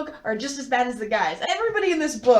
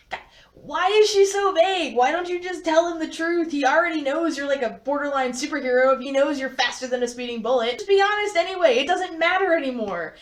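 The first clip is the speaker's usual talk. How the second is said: exasperated; 4 semitones higher than usual. Real, not acted. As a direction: beg the character to just be honest. Reader exasperated with Edith.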